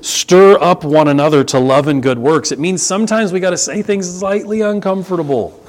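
usual speaking voice

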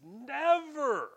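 "never" high, loud and very drawn out; over-the-top emphasis. Shown as a demonstration. Preacher translating Peter's double negative, exaggerated loud "never". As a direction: blow "never" up far beyond need. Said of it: "over-the-top, exaggerated, unnecessary way".

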